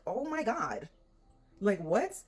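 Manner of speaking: high-pitched, stunned exclamation; retold shock. Tenant learning she is facing the owner, astonished. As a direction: act out amazement, voice lifted high.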